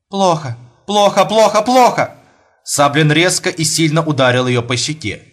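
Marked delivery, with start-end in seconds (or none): 0.1-2.1 hysterical. Acted. Wife in hysterics at table.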